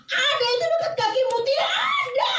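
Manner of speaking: extremely high, acted shout; imitated children yelling back and forth; children's panicked shouting. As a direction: shriek it between tree and ground. Shouted exchange around the tree.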